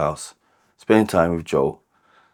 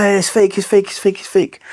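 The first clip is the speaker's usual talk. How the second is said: much higher, louder, frantic pleading to a stepdad; panicked denial; 13 semitones higher, 4 dB louder than usual. Retold or imitated. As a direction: blurt it out in a high panic, begging him to believe you.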